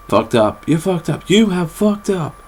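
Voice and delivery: high, soft sketch-comedy voice; Whitest Kids U Know bit. Retold or imitated. Vlogger quoting a comedy sketch mid-ramble, high put-on voice. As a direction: put on a high voice, drawing out the last repeat. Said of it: "that's, uh, whitest kids you know".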